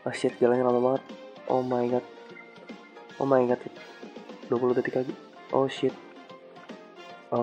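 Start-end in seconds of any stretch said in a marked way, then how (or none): none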